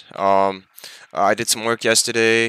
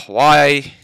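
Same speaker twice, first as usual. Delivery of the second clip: loud, exasperated why after a sigh; 4 semitones higher, 6 dB louder than usual. Real, not acted. Vlogger fed up with an unpaid client, loud exasperated. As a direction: throw the why out in frustration.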